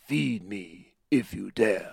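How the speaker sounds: slow, loud menacing cat hero; Puss in Boots voice. Retold or imitated. Podcaster acting out a fat Puss in Boots line, slow and loud. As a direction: drag out a grand, loud threat as a fat cat. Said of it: "and he goes".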